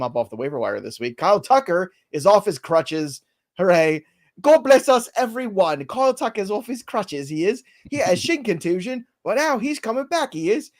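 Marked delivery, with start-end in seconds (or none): 1.2-9.1 put-on high-pitched plucky Dickens urchin, British accent; Tiny Tim. Host gives injury news as a Dickens character, Tiny Tim impression. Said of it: "that impression is so impressive". 9.2-10.7 put-on high-pitched plucky Dickens urchin, British accent; Tiny Tim. Host gives injury news as a Dickens character, Tiny Tim impression. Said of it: "Tiny Tim Charles Dickens".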